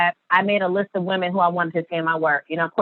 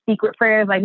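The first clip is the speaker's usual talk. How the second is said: normal talking voice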